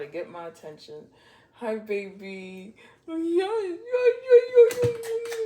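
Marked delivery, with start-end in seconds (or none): 1.5-2.7 tender. Real, not acted. Baby talk to pet. 3.1-5.4 high squealing yeahs to the pet; excited cooing. Real, not acted. Reader cooing at her excited pet, high squeal.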